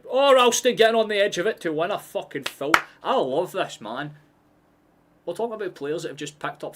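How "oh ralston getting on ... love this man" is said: loud, high, thrilled shout; excited yell. Real, not acted. Streamer yelling at the screen over a won foul, thrilled shout.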